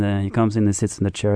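ordinary talk

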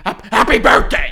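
loud, high barked imitation of mom; mock harsh yell; mom barking. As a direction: bark it out like an angry mother. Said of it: "My mom barked at me".

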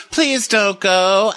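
high, drawn-out mock plea; hammed-up slow pleading; Rinna pleading. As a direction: beg in a slow, high put-on voice. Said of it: "and Rinna goes".

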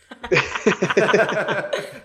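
high wild cackle; wild rambling cackles. Real, not acted. Joke about Old Man River.

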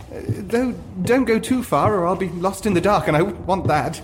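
high, playful tease; flirty actor. Acted. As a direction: flirt with him lightly.

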